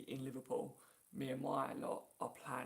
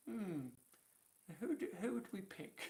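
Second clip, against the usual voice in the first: high-pitched mock musing over a choice; playful pondering; 6 semitones higher than usual. Liverpool fan picking players like a shopper, playful pondering. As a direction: ponder it aloud in a high, teasing voice.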